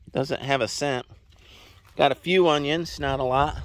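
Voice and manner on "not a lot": ordinary talk, nothing marked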